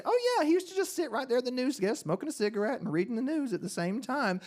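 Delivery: put-on high-pitched retort to shocked kids, easing down; high, animated assurance; mock matter-of-fact. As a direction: start high on the exclamation, then settle. Telling aghast schoolchildren about Cronkite.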